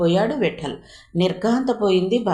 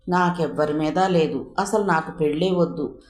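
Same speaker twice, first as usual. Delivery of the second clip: ordinary delivery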